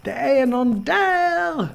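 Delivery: high, loud breathless cry; panting alarm. Acted. Frightened woman back at camp warning the others, panting. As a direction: gasp it out in alarm, stretched and high. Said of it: "flåsar hon".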